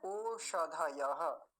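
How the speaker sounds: ओषधयः said slowly, ओ like English o, retroflex ष; teacher sounds out ओषधयः slowly; careful syllable-by-syllable reading. Shown as a demonstration. Teacher to students, slow clear ओषधयः. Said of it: "लेकिन यहाँ देखिए".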